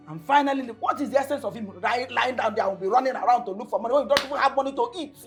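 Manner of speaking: fast, high and loud ranting; angry tirade. Acted. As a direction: rattle off the grievance in outrage. Mother rants about surgery money.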